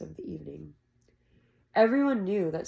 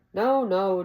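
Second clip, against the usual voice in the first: grumbling; 7 dB louder than usual. Acted. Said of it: "Jake grumbled".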